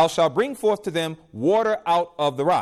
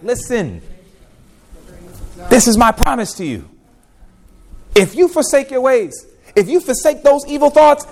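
rising, louder earnest plea as God; God's plea; 7 semitones higher, 4 dB louder than usual. Acted. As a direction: call out God's promise with growing force. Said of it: "God is like".

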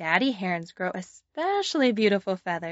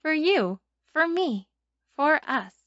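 higher, brighter emphasis on each; emphatic rising list; 7 semitones higher, 4 dB louder than usual. Acted. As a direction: build it up: you, me, us.

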